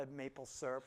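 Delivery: ordinary talk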